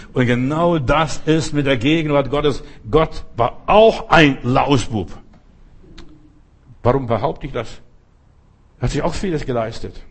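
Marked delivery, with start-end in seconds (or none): 2.9-5.2 slow, weighty, louder statement; emphatic punchline. Real, not acted. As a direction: lean on every word of it. Preacher's provocative punchline.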